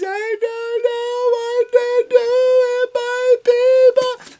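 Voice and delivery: high, slow, wailing fake sob. Comic mocking a celebrity's crying video, wailing high and slow. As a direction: ham up a teary celebrity breakdown.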